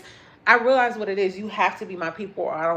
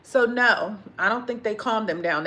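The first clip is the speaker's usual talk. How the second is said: ordinary talk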